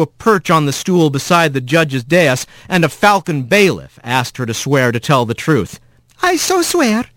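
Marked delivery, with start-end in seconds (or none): 6.2-7.1 small high chirpy wren; bright little bird witness. A narrator's reading.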